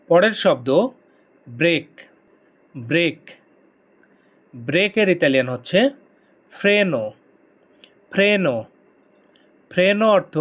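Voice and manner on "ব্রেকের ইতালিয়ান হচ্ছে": usual speaking voice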